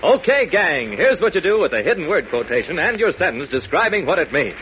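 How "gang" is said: ordinary talk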